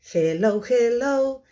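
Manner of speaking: put-on fast singsong kids' song; sped-up singsong puppet show host. Shown as a demonstration. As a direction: sing it again, very quickly. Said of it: "very, very quickly".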